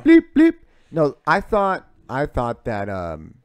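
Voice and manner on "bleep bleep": much higher, much louder bleep noise; mock bleep sound. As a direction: squeal out bleep like a censor tone. Imitating the censor bleep button.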